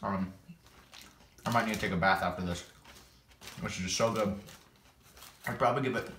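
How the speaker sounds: chewing salad while talking; talking between bites; mouth full. Real, not acted. Seen 1: Just ate salad forkful; lips pressed with dressing residue while chewing and talking.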